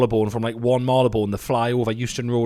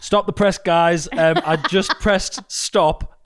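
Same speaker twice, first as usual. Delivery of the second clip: high, loud breaking-news call; mock announcer; 4 semitones higher, 5 dB louder, much slower than usual.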